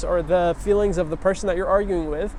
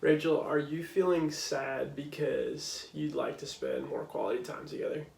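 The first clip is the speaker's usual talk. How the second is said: caring question; 4 semitones lower, 9 dB quieter than usual. Shown as a demonstration. Husband gently asking his upset wife Rachel about her feelings, soft and caring. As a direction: ask it tenderly, much softer, as if not to hurt her. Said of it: "So check this out".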